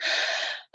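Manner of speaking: a quiet, slow breath out; soft demonstrative sigh. Shown as a demonstration. Parent naming feelings for the child: soft sigh. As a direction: sigh to show you are calming down. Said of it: "and comment, like".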